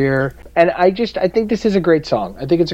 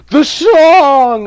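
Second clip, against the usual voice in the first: higher and louder, bursting out; excited exclamation; 12 semitones higher, 8 dB louder than usual. Real, not acted. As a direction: blurt it out in discovery. Sudden realization about song title.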